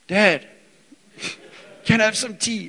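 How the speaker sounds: put-on high, loud little-kid plea; boy calling his dad for tea; toddler asking for tea. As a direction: call out like a three-year-old. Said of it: "and it's".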